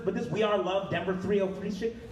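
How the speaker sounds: ordinary talk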